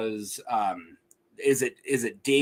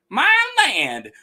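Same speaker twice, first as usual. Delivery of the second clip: high, loud Southern exclamation; hammed-up Southern accent; eccentric Southern; 12 semitones higher, 7 dB louder than usual. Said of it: "sound like eccentric Southern".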